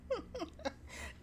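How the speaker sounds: short squeaky laugh; high giggle. Real, not acted. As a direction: break into a giggle. Laughs at the line.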